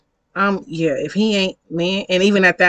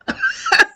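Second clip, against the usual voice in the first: high-pitched burst of laughter; real amused laugh, pitched way up. Real, not acted. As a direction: crack up at your own story. Laughs at own story.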